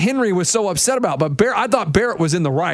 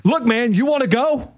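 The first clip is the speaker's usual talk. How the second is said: mock challenge; 4 semitones higher than usual. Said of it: "Then Barrett tells Henry".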